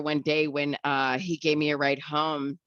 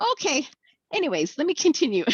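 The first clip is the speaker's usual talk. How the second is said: much higher, fast, brushing it off; chirpy carry-on; brisk lady voice; 14 semitones higher, 4 dB louder than usual. Retold or imitated.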